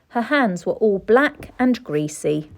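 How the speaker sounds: ordinary delivery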